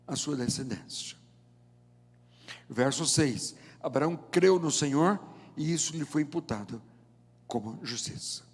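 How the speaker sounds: ordinary talk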